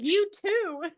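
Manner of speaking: high-pitched retold comeback; mock scolding retort. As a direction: playfully imitate an exasperated telling-off. Hermione's retort to Malfoy, retold.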